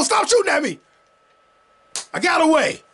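high, panicked yelp at the game; frantic yell. Real, not acted. Gamer fleeing guards in the game, frantic high yell. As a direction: shriek it as the bullets fly.

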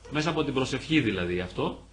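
usual speaking voice

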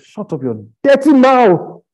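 high, loud, drawn-out shout; angry outburst. Real, not acted. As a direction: shout them down at full voice. Outburst against critics of believers.

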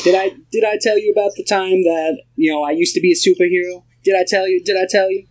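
high nasally eager voice; sustained high-pitched comic impression; Spike Lee. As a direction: imitate a nasal actor pestering with a boast. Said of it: "his voice is so funny".

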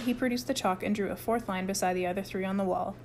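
plain reading voice

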